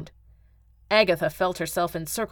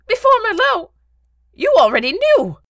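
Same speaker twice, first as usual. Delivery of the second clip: high, loud, aghast cry; shocked realization; 9 dB louder than usual. Acted. Beetle realizing the Baron knew, shocked outcry. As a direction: cry out as the truth dawns.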